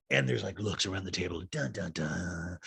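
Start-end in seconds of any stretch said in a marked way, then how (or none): 1.5-2.6 low, soft, slow dun-dun-dun; mock suspense sting. Recapper after looks around the table, hushed mock suspense. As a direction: voice a quiet, ominous cliffhanger sting.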